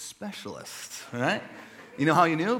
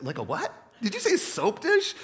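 incredulous friend; 9 semitones higher, 3 dB louder than usual. Retold or imitated. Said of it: "your friend's like".

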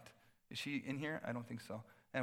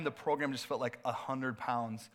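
ordinary talk

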